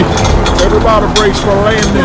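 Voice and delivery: high, loud, fast warning; frantic shout. Acted. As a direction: yell before the crash. Crash imminent.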